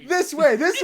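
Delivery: high loud mock calls; excited calling. Imitating squids pointing at them, excited calling. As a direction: cry it out over laughter.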